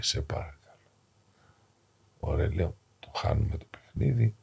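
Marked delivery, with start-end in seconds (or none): 0.0-0.8 faint whispered plea of a dying man; weak whisper. Retold or imitated. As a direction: barely breathe the plea. Dying man begs priest to leave.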